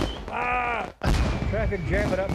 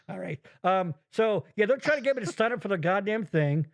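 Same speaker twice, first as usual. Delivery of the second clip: normal talking voice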